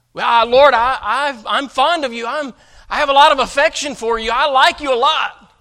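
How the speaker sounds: acted loud, halting insistence; insistent Peter. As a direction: blurt out awkward affection, stammering at first. Acting Peter's fumbling, insistent answer.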